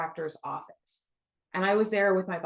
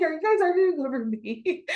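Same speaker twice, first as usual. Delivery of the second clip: high, incredulous retold question through laughter; amused mock bewilderment, pitched up; laughing disbelief; 8 semitones higher, 4 dB louder than usual. Her reacting to men arguing over her, in high laughing disbelief.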